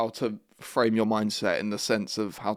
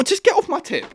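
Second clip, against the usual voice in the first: much higher, loud squeal; squeaky mock outburst; 4 dB louder than usual. Shrieking at the girl in the video to back off. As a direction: shriek it off as a joke.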